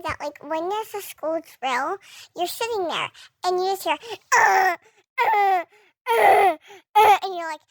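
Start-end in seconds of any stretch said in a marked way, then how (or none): 4.4-7.2 loud, blaring imitated alarm; alarm noise. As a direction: blast out the drill siren sound. Imitating school alarm sound.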